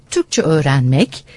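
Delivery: ordinary talk, nothing marked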